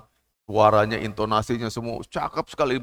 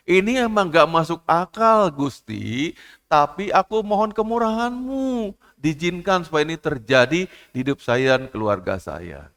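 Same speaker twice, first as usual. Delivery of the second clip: raised, earnest plea to God; pleading prayer; 6 semitones higher than usual. Acted. Believer praying to God for mercy, a lightly acted plea. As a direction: beg for mercy, voice lifted high. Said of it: "voiced prayer of a believer".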